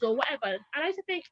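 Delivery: ordinary talk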